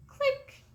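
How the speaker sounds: a tiny high "click" like a camera shutter. A narrator's reading. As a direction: say "Click" high and bright, a mental snapshot. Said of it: "Cam said".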